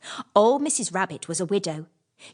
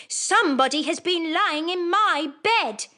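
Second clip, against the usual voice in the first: higher, medium bear voice; middle-sized bear voice; 9 semitones higher than usual. Acted. Middle bear notices her chair, higher voice. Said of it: "in his middle-sized voice".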